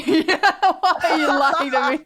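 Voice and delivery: high, slow, drawn-out protest; laughing mock accusation. Joking retort to the dog walker's kindness, laughing mock accusation.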